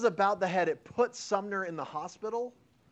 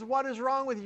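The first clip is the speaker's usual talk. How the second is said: mock yell; 9 semitones higher, 3 dB louder than usual. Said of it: "He starts yelling at him".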